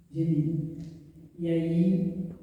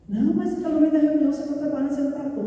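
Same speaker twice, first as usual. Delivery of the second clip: higher and louder, indignant; indignant protest; 9 semitones higher, 5 dB louder, faster than usual. Retold or imitated. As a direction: protest hotly on her behalf. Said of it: "O secretário de finanças ficou indignado". (secretário indignado protestando, mais alto e agudo)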